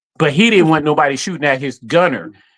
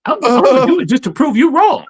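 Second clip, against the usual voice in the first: higher, cocky mock defiance; imitating a stubborn friend, pitched up; impression of Guru; 10 semitones higher, 3 dB louder than usual. Teasing friend imitating Guru, pitched up and cocky. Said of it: "I'm gonna do my impression of you".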